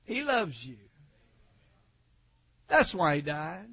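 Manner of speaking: soft, low after the climax; quiet, tender preaching; hushed sincerity. Real, not acted. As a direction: drop right down and let it land gently. Hushed drop after shouted climax.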